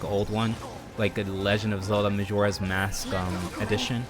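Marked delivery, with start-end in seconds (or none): none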